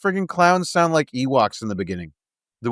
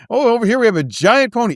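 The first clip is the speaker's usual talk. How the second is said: carnival barker; 8 semitones higher, 3 dB louder than usual. Acted. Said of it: "quoting ringmaster bit".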